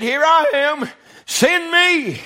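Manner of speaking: high, ringing "Here I am. Send me."; lifted, urgent offering of self; Isaiah's eager cry. Retold or imitated. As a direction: call out eagerly to be sent. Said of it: "He said".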